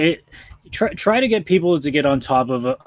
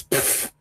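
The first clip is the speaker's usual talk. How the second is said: dismissive pfft; 7 dB quieter than usual. Real, not acted. Dismissive reaction to fan-project takedowns.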